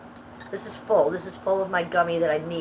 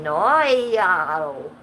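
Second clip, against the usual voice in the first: drawn-out, louder mock Australian no; Australian accent; 6 dB louder, much slower than usual. Streamer quoting Jesus's answer to her question, in a mock Australian accent. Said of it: "he said it in an Australian accent".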